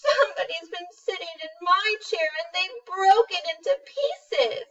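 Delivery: high, loud mock-crying cub; hammed-up whimpering little bear; crying baby bear. Baby bear wails over his broken chair, high and mock-tearful. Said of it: "Baby Bear's crying and says".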